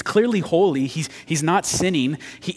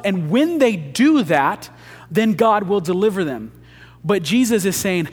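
rising, louder preacher's drive, then slowing on the key line; preaching at full force; 4 semitones higher, 4 dB louder than usual. Acted. Preacher to congregation, making his point in a rising, louder voice. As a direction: build the argument to a ringing peak.

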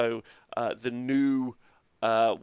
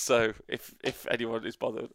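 ordinary talk, nothing marked

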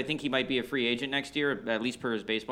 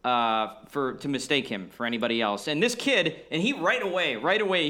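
ordinary talk, nothing marked

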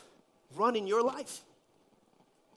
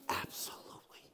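hushed emphasis; 13 dB quieter than usual. Real, not acted. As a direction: whisper it with conviction. Preacher's hushed aside for emphasis.

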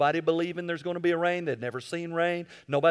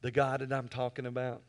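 tender altar call; 4 semitones lower, 7 dB quieter than usual. Real, not acted. Pastor inviting heads bowed, softly tender. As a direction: draw them in quietly.

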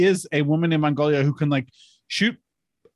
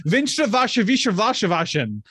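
higher, singsong tongue-twisting German; mock German; 4 semitones higher, slower than usual. Shown as a demonstration. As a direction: rattle through an endless German word. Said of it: "in German is ten syllables long".

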